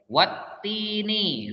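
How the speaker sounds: "wattini" slow, with the t doubled; tajwid reading. Shown as a demonstration. Teacher reads the Quranic word to pupils, drawn out. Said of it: "dibaca langsung wattini".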